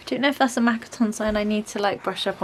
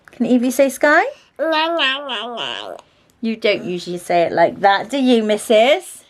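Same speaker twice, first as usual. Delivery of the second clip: higher, louder coaxing voice; 5 semitones higher, 9 dB louder, slower than usual. Real, not acted. Mum coaxing her toddler to speak, child-directed voice.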